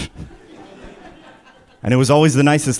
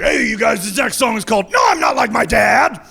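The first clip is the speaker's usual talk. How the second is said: mock band frontman; 9 semitones higher than usual. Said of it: "You're like".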